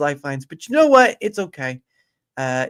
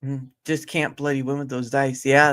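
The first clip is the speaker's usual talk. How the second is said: ordinary delivery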